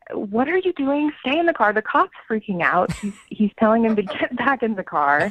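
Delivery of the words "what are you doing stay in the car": retold alarm. Retold alarm at date leaving car.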